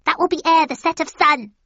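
fast, high witch voice; said quickly. A narrator's reading. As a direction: rattle it off like a hag. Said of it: "she said quickly".

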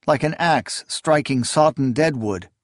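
ordinary delivery, nothing marked

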